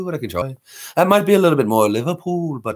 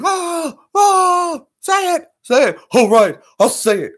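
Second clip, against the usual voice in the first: Bobcat yelling; 8 semitones higher than usual. Acted. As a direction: squeal it out like Bobcat Goldthwait. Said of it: "He's got that yelling kind of thing".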